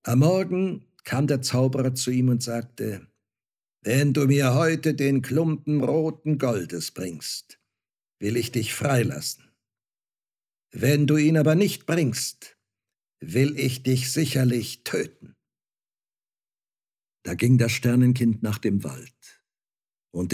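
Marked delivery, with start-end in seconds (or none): 3.8-15.4 measured threat from a cruel master, mildly voiced; threatening magician. A narrator's reading. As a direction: lay down the ultimatum, leaning on the condition. Magician threatens his slave. (drohender Zauberer, leicht gespielt)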